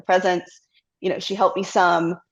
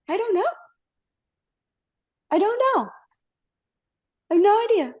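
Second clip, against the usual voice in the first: much higher, bewildered "I don't know"; dazed uncertainty; 12 semitones higher, faster than usual. Retold or imitated. Wife answering husband about quitting her job, much higher and bewildered. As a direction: answer lost and bewildered, voice up high. Husband asks if she quit.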